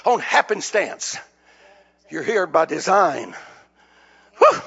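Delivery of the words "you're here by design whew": ordinary talk, nothing marked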